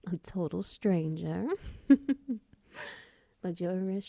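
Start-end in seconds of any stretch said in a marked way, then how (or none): 1.5-2.4 brief, higher-pitched giggle; amused little laugh; light giggle. Acted. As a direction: giggle playfully at the thought. Amused at helping a total stranger.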